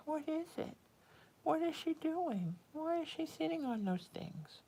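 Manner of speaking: high, soft, wondering cat voice; voicing the curious cat. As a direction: puzzle over it softly in a high voice, like the cat. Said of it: "He spends hours wondering".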